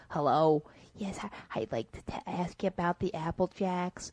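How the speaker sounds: hushed, hesitant put-on caller voice; phony caller. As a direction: stumble softly through a fake prank call. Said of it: "if you go in there and say".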